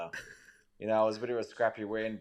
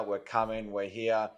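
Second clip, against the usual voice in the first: normal talking voice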